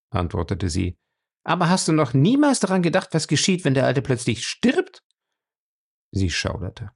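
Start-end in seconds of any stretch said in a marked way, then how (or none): 1.4-5.0 high, worried female character voice; raised-pitch woman in dread; anxious captive woman. A narrator's reading. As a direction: press him with alarm, pitched high.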